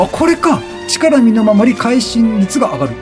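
usual speaking voice

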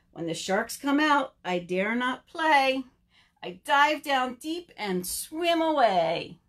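slow rhythmic recital; children's rhyme. Acted. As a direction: chant it slowly with stretched endings.

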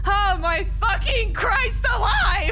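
high, drawn-out terrified yelling; panicked screaming. Real, not acted. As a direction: plead and curse in a real panic. Scared while trying to hide.

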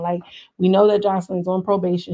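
normal talking voice

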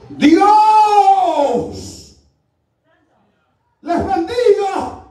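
huge drawn-out preacher's shout; booming blessing. Retold or imitated. Visiting preacher greets the congregation, booming and drawn out. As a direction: cry out the first word, then stretch the blessing.

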